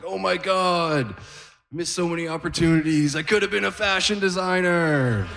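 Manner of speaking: hammed-up high wail of an old man; mock weeping. Granddad seeing color for the first time, mock-weeping wail. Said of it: "immediately he starts weeping".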